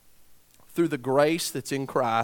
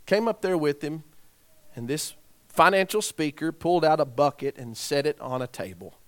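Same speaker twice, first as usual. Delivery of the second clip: ordinary talk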